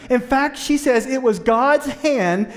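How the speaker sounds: loud, high, building sermon delivery; full-force preaching. Real, not acted. Pastor pressing the congregation on God's role, loud and rising. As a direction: drive the point home from the pulpit.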